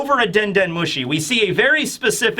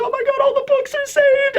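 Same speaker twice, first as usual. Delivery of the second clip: squeaky, very high tearful squeal; hammed-up happy sobbing voice; mock crying. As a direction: imitate overjoyed weeping, way up high. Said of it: "Robin is, like, crying here".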